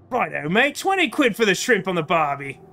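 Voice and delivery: hammed-up stock Australian accent. Shown as a demonstration. Streamer showing their Australian accent with a stock phrase, bad Australian. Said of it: "that's what my Australian accent is".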